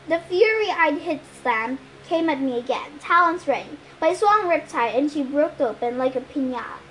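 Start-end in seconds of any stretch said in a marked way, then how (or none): none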